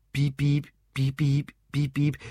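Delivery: short, even electronic beeps; imitated beeping. Drunk Lou mimics his phone for the barman, beeping. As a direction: mimic a phone's repeated beep.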